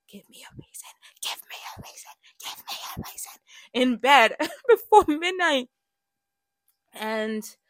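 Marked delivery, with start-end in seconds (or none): 0.1-3.3 breathless pleading whisper, over and over; desperate whisper. Retold or imitated. Begging God from bed before midnight, repeated whisper. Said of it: "being like".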